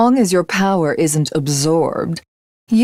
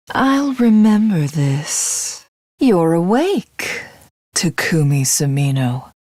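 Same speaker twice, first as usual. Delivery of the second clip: usual speaking voice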